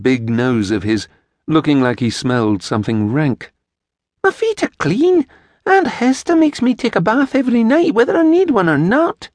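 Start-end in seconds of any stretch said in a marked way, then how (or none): 4.2-9.3 put-on high-pitched child protesting; indignant little girl. A narrator's reading. Little girl defends her clean feet, high indignant child's voice. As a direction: argue back as a small girl, pitched way up.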